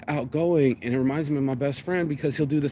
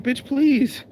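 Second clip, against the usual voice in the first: sassy; 8 semitones higher, 4 dB louder than usual. Retold or imitated. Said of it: "homegirl said".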